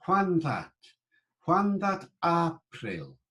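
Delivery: high, slow "Juan" as HWAHN, "April" as AH-pril; Middle English pronunciation. Shown as a demonstration. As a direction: model it for repeating, "April" with open AH. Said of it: "say the words along with me".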